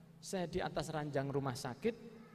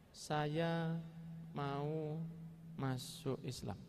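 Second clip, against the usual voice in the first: slow, halting words fading softer; bicara pelan sekali; 3 semitones lower, 4 dB quieter, much slower than usual. Retold or imitated. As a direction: imitate a slow talker, pausing between words. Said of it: "Ngomongnya pelan sangat". (menirukan orang bicara pelan, terputus-putus)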